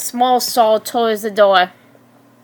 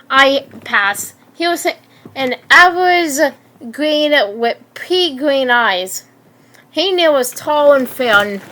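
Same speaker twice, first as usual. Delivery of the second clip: ordinary delivery, nothing marked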